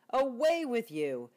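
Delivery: loud, high shout; shooing bear. Acted. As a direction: chase him off loudly. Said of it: "rumbled Bear".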